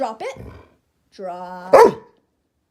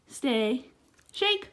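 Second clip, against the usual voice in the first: ordinary delivery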